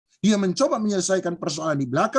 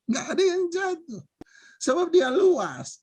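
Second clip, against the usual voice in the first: said again through laughter, higher; amused echo, pitched up; laughing repeat; 8 semitones higher than usual. Real, not acted.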